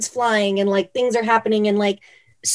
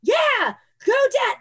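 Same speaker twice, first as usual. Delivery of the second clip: put-on very high-pitched kid's cheer; mock yell; slower than usual. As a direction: imitate an attention-seeking child yelling, high. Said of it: "'cause I'm yelling something".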